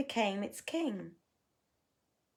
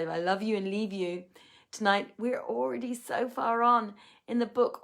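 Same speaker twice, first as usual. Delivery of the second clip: plain reading voice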